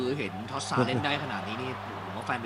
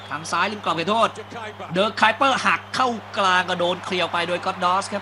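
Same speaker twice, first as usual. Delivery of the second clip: louder, higher call of the attack as it unfolds; excited football commentary, louder and higher; live play-by-play; 5 semitones higher, 7 dB louder than usual. Acted. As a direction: ride the cross into the box with rising energy.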